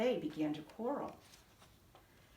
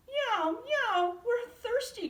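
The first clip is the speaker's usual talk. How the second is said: high, loud mewing cats; chorus of thirsty cats; crying cats; 14 semitones higher, 5 dB louder, slower than usual. A narrator's reading. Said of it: "cried the".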